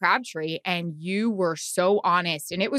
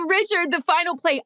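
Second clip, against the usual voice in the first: a light mock of her stiff reporter voice, higher; mocking her own question; 9 semitones higher than usual. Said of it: "My first question sucked ass".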